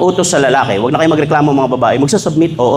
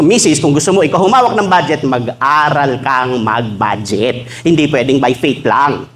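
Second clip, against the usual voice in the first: normal talking voice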